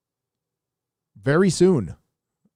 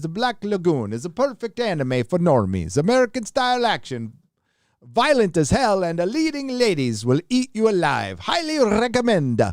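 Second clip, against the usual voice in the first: mock Italian accent; 6 semitones higher than usual. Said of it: "I just imagined you as a really Italian person with a bad Italian accent".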